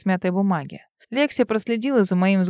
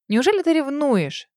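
indignant fury; 7 semitones higher than usual. A narrator's reading. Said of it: "Рит кипит от ярости и с возмущением говорит".

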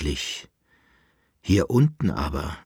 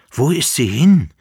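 louder, higher desperate call; 5 semitones higher, 8 dB louder than usual. A narrator's reading. The Emperor waking from his dream, calling out loudly. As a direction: cry it out into the empty room. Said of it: "rief der Kaiser".

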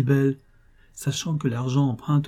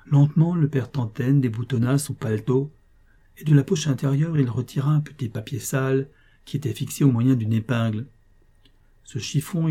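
usual speaking voice

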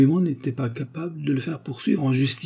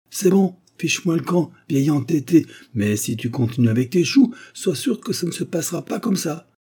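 high-pitched, exasperated warning; irritated dismissal; 7 semitones higher than usual. A narrator's reading. Mayor dismissing stubborn widower with a warning, irritated. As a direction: send the stubborn fellow packing.

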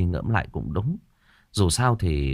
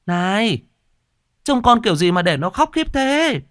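high, loud scolding wife; shrill-pitched, impatient yell at a husband; impatient calling out; 13 semitones higher, 4 dB louder than usual. A narrator's reading. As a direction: holler an irritated scolding from another room. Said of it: "sốt ruột nói vọng lên".

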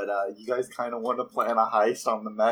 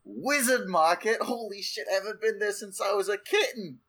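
excited; 9 semitones higher than usual. Acted. Excited cat character's delight.

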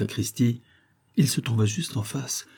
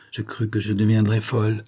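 plain reading voice

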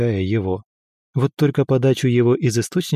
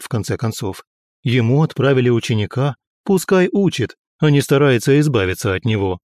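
ordinary delivery

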